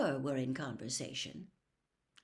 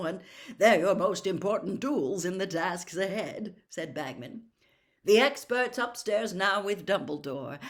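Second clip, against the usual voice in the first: hearty Bagman; 3 semitones higher, 9 dB louder than usual. Acted. Jolly judge welcoming the young champion, loud and booming. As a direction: welcome him with big bluster.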